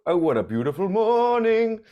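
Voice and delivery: ordinary talk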